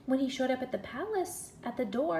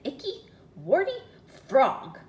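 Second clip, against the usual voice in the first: disgusted; 5 semitones higher, 10 dB louder, slower than usual. Acted. Disgusted reveal of the frog.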